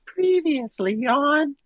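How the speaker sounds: put-on high, slow kid voice; precocious child. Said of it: "recording of some, like, precocious child".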